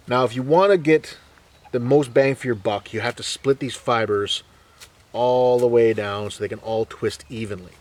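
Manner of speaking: ordinary talk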